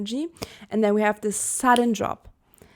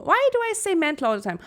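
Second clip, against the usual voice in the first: exasperated at herself; 8 semitones higher, faster than usual. Real, not acted. Exasperated at her own slip.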